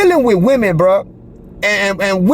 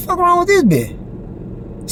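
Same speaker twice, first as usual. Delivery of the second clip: incredulous; 10 semitones higher, much slower than usual. Retold or imitated. His inner reaction to her stare.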